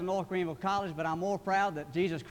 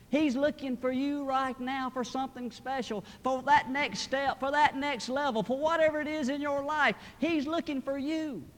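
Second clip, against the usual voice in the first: high, earnest preacher's appeal; preaching; 7 semitones higher, 3 dB louder than usual. Real, not acted. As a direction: press it on the congregation in a raised pitch. Preaching to the congregation.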